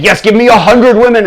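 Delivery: high, loud, eager cry; greedy frenzy. Acted. King snapping up the lamp's offer, frenzied.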